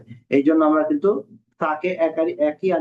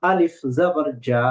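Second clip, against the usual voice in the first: slow, holding জা out; slow elongated recitation demo; stretched lesson demo; slower than usual. Shown as a demonstration. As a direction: draw the syllable out for students. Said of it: "জিমকেও তিন আলিফ টান দিলাম".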